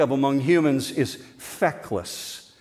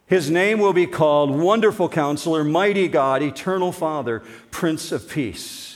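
louder, stately reading, slowing at the end; solemn proclamation; proclaiming scripture; 4 semitones higher, 3 dB louder than usual. Acted. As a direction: announce each title with weight. Proclaims the titles.

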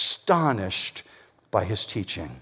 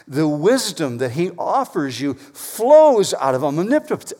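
ordinary delivery

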